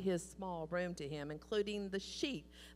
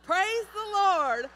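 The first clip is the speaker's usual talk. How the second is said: high, loud, jubilant shouting; ecstatic praise; 10 semitones higher, 8 dB louder than usual. Retold or imitated. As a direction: burst out in triumphant joy. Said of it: "jumping up and down and clapping her hands and said".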